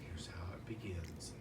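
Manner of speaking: unvoiced hushed aside; whisper. Real, not acted. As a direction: drop into a secretive whisper. Whispered aside mid-story.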